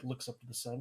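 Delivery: normal talking voice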